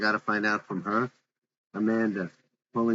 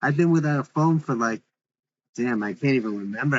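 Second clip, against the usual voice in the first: raised up, louder and higher; 3 semitones higher, 4 dB louder than usual. Acted. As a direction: push it out louder.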